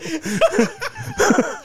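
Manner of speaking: very high squeaky laugh; cartoon laugh.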